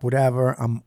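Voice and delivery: ordinary talk, nothing marked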